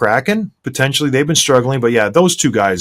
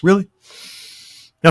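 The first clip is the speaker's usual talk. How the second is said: usual speaking voice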